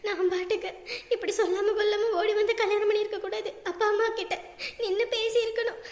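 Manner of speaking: sobbing, guilt-laden confession; tearful regret. Acted. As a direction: speak through sobs, blaming yourself. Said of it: "ஏமா இப்படி அழுதுகிட்டே கிடக்குற". (அழுதுகொண்டே வருந்திப் பேசுதல்)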